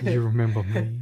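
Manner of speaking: laughing mock voice. Joining the mock cell bit.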